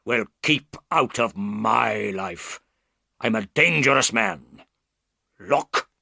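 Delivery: darker bullying man's voice; a big bully trying to intimidate; hint of a menacing brute. Roylott confronts Holmes about his stepdaughter, menacing.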